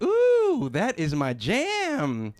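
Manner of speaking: high swooping "ooh", then slow drawn-out relish; delighted seniors. Acted. Seniors hearing their favorite song, excited and drawn out. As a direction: savor it like your favorite song just came on. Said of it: "They hear that song, they're like".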